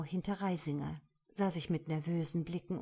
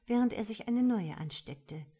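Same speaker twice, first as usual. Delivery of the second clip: ordinary delivery, nothing marked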